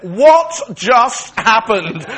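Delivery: very slow, loud, high exclamation; stunned disbelief. Retold or imitated. Man in split trousers coming to terms with it, stunned disbelief. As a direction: drag each word out in loud, appalled disbelief. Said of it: "trying to come to terms with this terrible moment".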